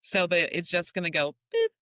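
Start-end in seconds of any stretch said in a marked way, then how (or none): 1.5-1.7 a short, playful mouth-made boop; boop sound effect. Retold or imitated. Imitating the breast lifting for the host with a playful boop. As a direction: pop it out like a cartoon sound. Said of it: "you get sound effects too".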